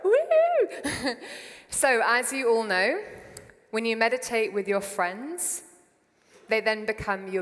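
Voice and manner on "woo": high, loud "woo"; whoop. Real, not acted. Speaker cheering on last year's meditators, high whoop. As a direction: let out a high crowd-rousing whoop. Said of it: "give me a whoop".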